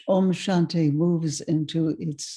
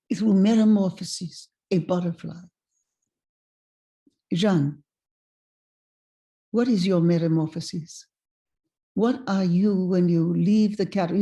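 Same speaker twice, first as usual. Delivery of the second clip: imitated old Frenchman, wondering; lightly acted French accent. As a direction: pose it as a French-accented mentor. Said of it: "he said to me in a French accent".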